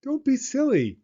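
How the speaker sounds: high, light frog voice; cheerful frog. Acted. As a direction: tease a sleepy friend. Frog's voice.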